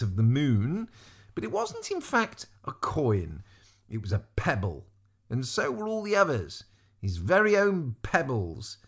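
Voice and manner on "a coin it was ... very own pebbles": plain reading voice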